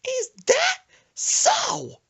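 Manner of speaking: very high, loud and slow mock question; grandma's shrill drawl. Grandma mocking Brandon's threat, very high and slow. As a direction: squeal it out in disbelief.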